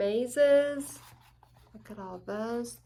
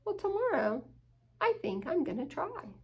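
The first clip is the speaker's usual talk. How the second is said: higher, bright little dog resolve; lightly voiced determined pup; eager puppy; 6 semitones higher, 3 dB quieter than usual. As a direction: say it like a small dog's brave plan. Hugo resolves.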